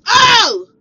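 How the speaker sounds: shouted, high, incredulous "oh"; mocking outburst. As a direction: blast his one word back at him. Exaggerates the ex's only response.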